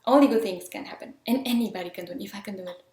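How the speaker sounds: ordinary talk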